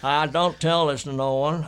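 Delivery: hammed-up American accent for a laugh. Shown as a demonstration. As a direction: put on a big American accent. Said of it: "Should be doing it in an American accent".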